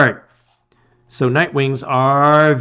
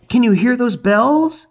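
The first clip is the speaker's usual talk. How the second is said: excited question; 10 semitones higher than usual. A narrator's reading. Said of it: "face aglow with excitement".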